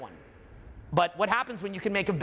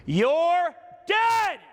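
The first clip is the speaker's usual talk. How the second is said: high loud burst; sudden shout; 10 semitones higher, 4 dB louder than usual. Acted. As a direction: jolt them awake. Sudden burst mid-meditation.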